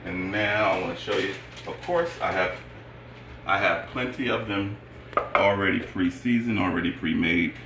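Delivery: usual speaking voice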